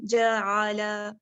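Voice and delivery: जाआला said slowly, zabar kept short, not stretched; short vowel recited. Shown as a demonstration. Teacher recites जाआला slowly for students. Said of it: "अब इसको लंबा नहीं किया हम लोगों ने".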